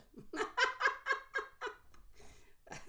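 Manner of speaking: a bright breathy giggle burst; high airy laugh. Real, not acted. Reader laughing at viewer's pretty good, airy laugh. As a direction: let a high laugh spill out.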